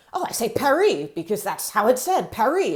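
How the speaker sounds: put-on Paris with a silent S in an American accent; anglicized French Paris. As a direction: say Paris as "pair-EE", English R, no S. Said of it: "that's not actually the right pronunciation".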